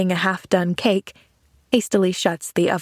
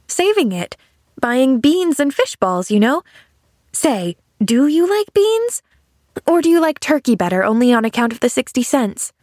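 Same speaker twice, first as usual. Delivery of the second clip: put-on higher, lilting child's questioning; curious little girl Pollyanna; 5 semitones higher than usual. Girl asking man about beans, innocently chatty. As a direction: ask with a child's frank curiosity.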